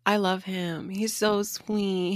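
slow, soft and fond; soft gushing. Real, not acted. Fan gushing softly about her favourite housemate, slow and fond. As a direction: melt a little as you say it.

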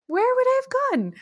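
high-pitched, rushed disbelief; exasperated. Real, not acted. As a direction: throw the question back, incredulous. Echoes the question, exasperated.

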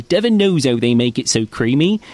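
a playful West Country custard-ad read; West Country accent. Retold or imitated. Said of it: "and they all talk like this".

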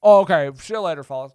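stammering old movie star impression; Jimmy Stewart. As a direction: hem and haw like an old Hollywood actor. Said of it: "Thanks, Jimmy".

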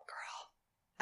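unvoiced ghostly whisper; eerie whisper. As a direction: breathe it like a ghost. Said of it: "That was eerie".

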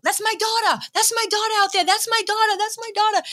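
high, excited imitation of a Boston dad; Boston accent. Proud dad telling everyone around him, high Boston-accented boasting. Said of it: "So his accent".

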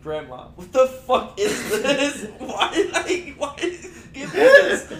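high, loud, laughing demand at grandma; mock outraged yell. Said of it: "I didn't say it like that".

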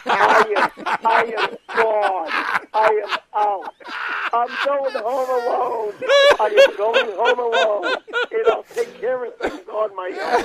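high, slow declaration through laughter; laughing. Real, not acted. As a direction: crack up while swearing you're leaving. Cracking up at his own impression.

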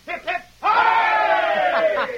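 very loud, slow, drawn-out hooray; rousing cheer. Acted. Foreman leading the workers in a cheer, very loud and drawn out. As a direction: lead the whole crowd in a cheer. Leads a cheer for the masked man.

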